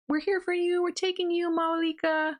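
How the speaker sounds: high, sustained, level-toned voice; ghostly rescuers. Retold or imitated. Urabi tell the fading Sylvia they take her, high and even. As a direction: imitate faces appearing to someone fading out. Apparitions speak as she fades.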